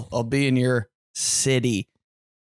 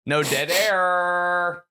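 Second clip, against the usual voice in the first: higher, slow, stretched last word; drawn-out radio parody; 4 semitones higher, much slower than usual. Pretend. Co-host joking about radio filling silence, drawn-out mock announcer. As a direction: hold the final word like a broadcaster.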